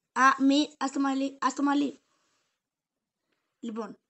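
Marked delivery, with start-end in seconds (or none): 0.1-2.0 sharp command. Real, not acted. Stopping the puppy biting.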